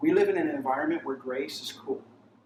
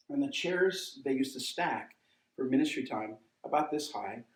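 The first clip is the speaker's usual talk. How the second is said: ordinary talk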